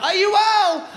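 high, loud stage holler; re-enacted yell to a crowd; shouted greeting. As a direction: holler it out to the whole room.

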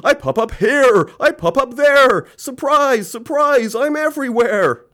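very high, loud swooping voice; manic show-off character. Acted. Surprising Ben popping up everywhere, high theatrical cries. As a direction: sing out each phrase with a flourish. Man pops out, announces himself.

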